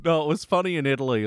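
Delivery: ordinary delivery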